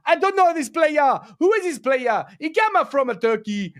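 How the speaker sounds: badly faked Nigerian accent, pitched high; hammed-up Nigerian accent; terrible mock Nigerian accent. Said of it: "that's a terrible accent".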